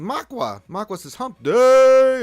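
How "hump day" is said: high, loud playful shout; loud hump day callout. Real, not acted. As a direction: yell it out for fun. Reading chat joke.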